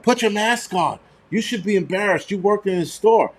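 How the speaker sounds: mild mock yell, indignant and high; retold scolding yell. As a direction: imitate an old lady telling someone off. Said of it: "was yelling at me".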